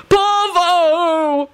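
high, long-held wail of the name. A narrator's reading. Baldy cries his dead friend's name, wailing. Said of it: "Baldy wailed".

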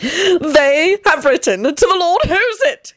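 slow, high theatrical glee; delighted high proclamation; gleeful announcement. A narrator's reading. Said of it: "he announced with glee".

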